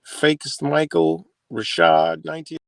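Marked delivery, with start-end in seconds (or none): none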